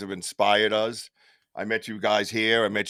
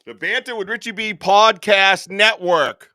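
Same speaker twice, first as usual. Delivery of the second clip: announcer reveal again; 8 semitones higher, 8 dB louder than usual. Acted.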